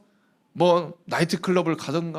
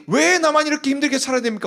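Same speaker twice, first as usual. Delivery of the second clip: bitter complaint pitched higher; bitter prayer; 6 semitones higher than usual. Acted. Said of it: "원한 맺힌 기도를 하다가".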